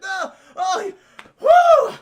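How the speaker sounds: high yelps building very loud; hammed-up frantic effort noises; mock struggling cries. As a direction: cry out as you scramble inside. Imitating struggle to shut car.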